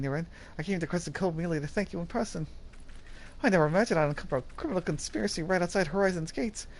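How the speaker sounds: earnest, alarmed guard raising the alarm; earnest young guard. Acted. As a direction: play the eager guard who just stumbled on a plot. Voices earnest Brass Blades guard.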